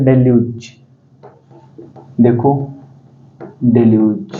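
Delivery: डेल्यूज said slowly, syllable by syllable; teacher modelling डेल्यूज; pronunciation demo. Shown as a demonstration. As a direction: model the word डेल्यूज carefully for students. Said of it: "अगर pronunciation देखें, थोड़ा सा tough है".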